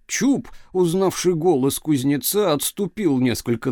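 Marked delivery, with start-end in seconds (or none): none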